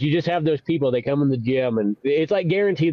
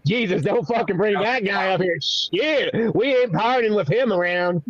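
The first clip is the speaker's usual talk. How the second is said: higher-pitched, hammed-up protest; exasperated Jesus; 5 semitones higher than usual. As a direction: wave someone away in mock alarm. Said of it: "even Jesus was like".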